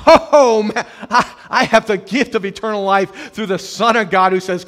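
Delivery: loud, high burst of wonder; overjoyed believer. Acted. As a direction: burst out thrilled by the gift.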